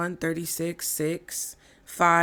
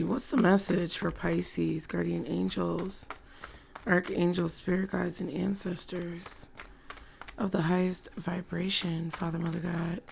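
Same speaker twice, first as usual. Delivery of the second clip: invocation; 5 dB quieter than usual. Acted. Calls on spirit guides in prayer.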